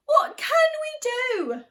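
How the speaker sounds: high, loud desperate cry; alarmed villagers calling out. Acted. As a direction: wail it like a panicked crowd. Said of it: "till the villagers cried".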